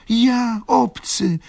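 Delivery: high, slow, wounded question; the steward, hurt, echoing back the word obcy; reproachful. A narrator's reading. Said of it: "spytał rządca tonem wymówki".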